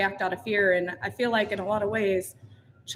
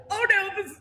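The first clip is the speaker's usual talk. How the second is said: sudden high yelp of alarm; 12 semitones higher than usual. Retold or imitated. Telling how the horse scared her, a high startled cry. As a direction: jump up in pitch, startled. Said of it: "And I was like".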